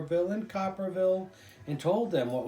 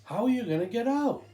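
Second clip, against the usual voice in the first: put-on high, louder child's question; a boy calling down into the cellar; 9 semitones higher, 6 dB louder than usual. A narrator's reading. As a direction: ask it like a curious small boy. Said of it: "the little boy said".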